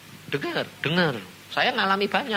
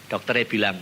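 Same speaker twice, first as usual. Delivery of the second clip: ordinary talk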